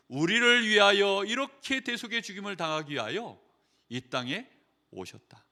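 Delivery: usual speaking voice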